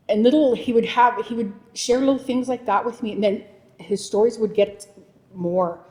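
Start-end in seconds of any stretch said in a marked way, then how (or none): none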